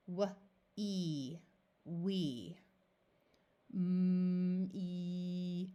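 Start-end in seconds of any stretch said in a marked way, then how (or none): 0.8-1.4 drawn-out vowel e; sounded out slowly. Shown as a demonstration. Teacher blending a word for beginners, stretched. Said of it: "we sound it out slowly". 3.7-5.7 M and e stretched one by one; sounded out slowly. Shown as a demonstration. Teacher blending sounds for beginners, stretched. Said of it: "we sound it out slowly".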